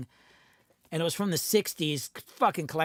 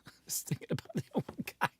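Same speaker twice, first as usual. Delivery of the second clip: whispered laugh; 9 dB quieter, faster than usual. Real, not acted. Remembering the old guy, cracking up.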